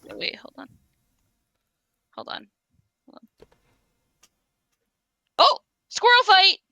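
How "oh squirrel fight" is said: excited. Real, not acted. Seeing squirrels fight outside.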